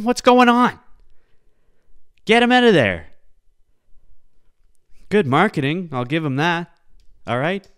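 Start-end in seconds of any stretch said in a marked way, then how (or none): 0.0-3.1 high, loud disbelief; loud, high exasperated shout; exasperated outburst. Real, not acted. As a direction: yell at the screen. Exasperated at kids' videos.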